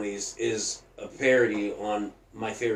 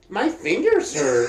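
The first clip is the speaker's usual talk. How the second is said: put-on slow, higher old-lady complaint; imitated old lady; 6 semitones higher than usual. Old lady complaining to the orderly, imitated slow high voice. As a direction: whine it slowly as a mock old lady. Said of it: "And the old lady's like".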